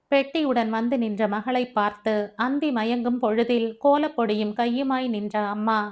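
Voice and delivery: usual speaking voice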